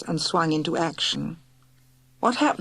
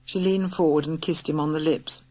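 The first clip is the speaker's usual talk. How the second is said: usual speaking voice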